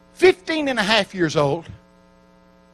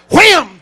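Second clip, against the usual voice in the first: a loud, punchy wham like a body hitting the floor; mock slam sound; 9 semitones higher, 11 dB louder than usual. Preacher acting out the woman being struck down, loud wham. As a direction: spit out the wham as a sudden impact. Holy Ghost knocks her down.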